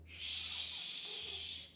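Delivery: a whispered hush; shushing. A narrator's reading. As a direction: hush them quickly. Kid hushes Kiko.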